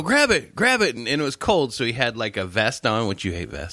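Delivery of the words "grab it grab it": high, urgent plea; panicked urging. Retold or imitated. Storyteller playing Bob begging for the lizard's removal, high and frantic. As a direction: imitate a frantic coworker.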